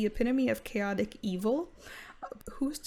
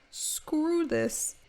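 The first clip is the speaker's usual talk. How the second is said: high exasperated; 7 semitones higher than usual. Pretend. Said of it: "He's like".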